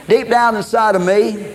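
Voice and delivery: usual speaking voice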